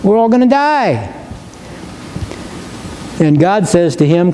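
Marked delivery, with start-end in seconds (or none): 0.0-1.1 higher, pleading mock panic; panicked cry to God. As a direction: whine it like a frightened leader. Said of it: "he's crying out to God".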